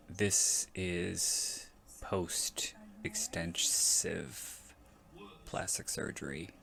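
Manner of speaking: slow whispered aside, regretful; hushed, rueful aside; sad whisper. Real, not acted. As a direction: drop to a slow whisper as if it pains you. Sad aside on her surgery.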